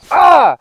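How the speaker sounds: short sudden high shout of embarrassment; loud flustered "Ah!" cry; embarrassed yelp. Acted. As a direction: yelp at your own slip. Embarrassed at blurting out punctuation.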